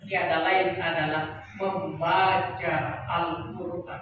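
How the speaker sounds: slow, low-pitched delivery; measured statement. Real, not acted. Preacher introduces Quran reading to congregation, slow and low.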